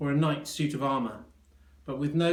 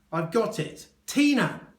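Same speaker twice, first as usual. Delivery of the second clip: high, loud triumphant cry; triumphant guess; 9 semitones higher, 6 dB louder than usual. A narrator's reading. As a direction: shout the name like you've cracked it. Doctor triumphant wrong guess.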